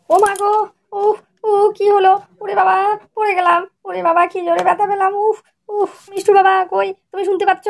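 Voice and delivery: mock-injured wife calling out; pretend pain cries. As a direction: fake a bathroom fall to lure husband. Wife fakes a fall, cries out.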